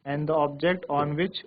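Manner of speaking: very slow, each phrase spaced out; slow dictation. Shown as a demonstration. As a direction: dictate slowly for notes. Dictating definitions to class. (धीरे-धीरे लिखवाना)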